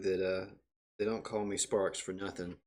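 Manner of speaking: ordinary talk, nothing marked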